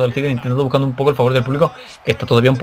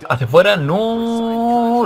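excited call; 8 semitones higher, much slower than usual. Real, not acted. Move called live.

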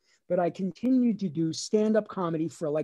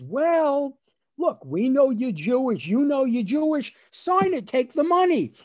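insistent father; 7 semitones higher, 5 dB louder than usual. Retold or imitated. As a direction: imitate an exasperated father, high and loud. Father urging son to take money.

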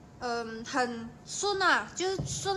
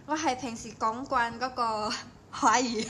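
normal talking voice

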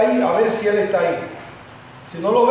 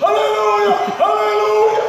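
much higher, ringing cries; joyful cheer; 12 semitones higher, 3 dB louder, slower than usual. Retold or imitated. Preacher imitating the congregation cheering the goal. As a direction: shout it like celebrating a goal. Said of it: "salieron los aleluya".

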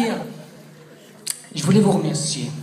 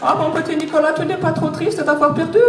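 Angela's voice; 12 semitones higher than usual. Pretend. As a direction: coo like an adoring Angela on the phone. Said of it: "Oui, allô, Angela".

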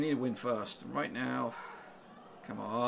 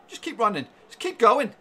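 rising, louder yell of encouragement; cheering shout; 6 semitones higher, 12 dB louder than usual. Real, not acted. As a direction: roar the runner on, building up. Urging player on the run.

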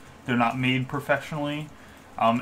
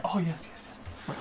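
soft, quick mutter, slightly higher; 4 semitones higher, 11 dB quieter than usual. Acted. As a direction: mutter it to yourself, half under your breath. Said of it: "he does a muttering".

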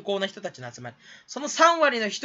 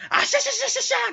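high rapid ashashasha cackle; cackling laugh imitation; faster than usual. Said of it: "みたいな人". (甲高い早口の笑い声のまね)

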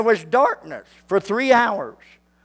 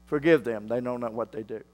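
hushed, near silent; 10 semitones lower, 9 dB quieter, faster than usual. Acted. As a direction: drop to a near whisper, tender. Quiet repeat of Jesus's words.

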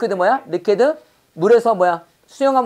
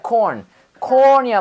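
ordinary talk

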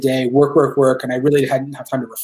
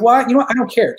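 defiant; 8 semitones higher, 4 dB louder than usual. Retold or imitated. Defiant retold reply to doubters.

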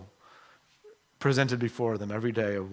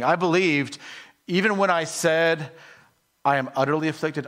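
higher and louder, pressing the words; emphatic reread; 6 semitones higher, 7 dB louder than usual. Shown as a demonstration. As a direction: proclaim it with full conviction. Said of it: "He's saying".

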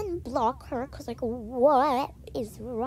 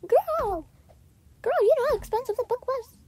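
much higher, louder and fast; hammed-up exasperated telling-off; 10 semitones higher, 8 dB louder than usual. Narrator scolding her friend, loud exasperated mock. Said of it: "I'm like".

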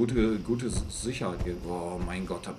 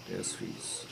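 a hushed whisper of "Der ist fies"; whispered aside; 14 semitones lower, 11 dB quieter than usual. Acted. Same words repeated whispered.

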